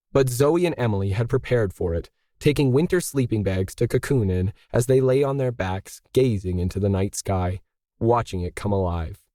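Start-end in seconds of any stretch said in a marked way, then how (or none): none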